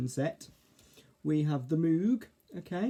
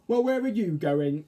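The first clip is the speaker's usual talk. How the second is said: louder, higher question, lightly in character; lightly voiced Arthur; 5 semitones higher, 7 dB louder than usual. A narrator's reading. Caterpillar asks fairy about her holiday, lightly voiced, louder.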